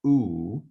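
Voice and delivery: "oo" as one pure, sustained vowel; isolated "oo" vowel, held for demonstration; long oo vowel. Shown as a demonstration. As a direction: sound out "oo" on its own. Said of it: "a vowel pronunciation that sounds like".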